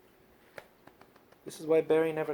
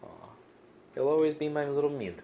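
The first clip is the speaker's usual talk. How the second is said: ordinary talk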